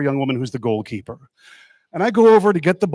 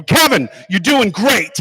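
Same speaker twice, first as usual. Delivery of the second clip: cheering encouragement; 4 semitones higher, 6 dB louder than usual. Retold or imitated. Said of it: "stick his head in my office and go".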